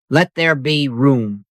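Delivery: ordinary talk, nothing marked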